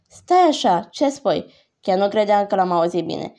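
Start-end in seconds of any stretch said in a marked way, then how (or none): 0.1-1.5 sudden high, loud "wait, what?"; stunned outburst; shocked disbelief. Acted. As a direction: caught off guard by the news. Learns dad threw helmet away.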